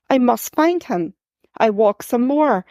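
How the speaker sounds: plain reading voice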